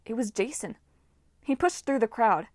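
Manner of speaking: ordinary delivery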